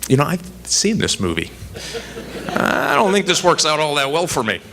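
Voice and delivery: high, anxious thought with a laugh; nervous inner voice. Retold or imitated. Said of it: "and I'm thinking".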